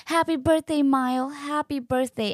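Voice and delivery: high, cheery singsong greeting; celebratory, pitched well up; excited well-wishing. Retold or imitated. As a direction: imitate a delighted crowd of nurses. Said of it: "so positive and so excited".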